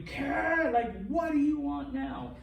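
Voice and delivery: put-on high, exasperated teenage snap; annoyed teen. Teacher imitating an annoyed daughter, high and fed up. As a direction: sound fed up, like a daughter being pestered. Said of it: "it would always be".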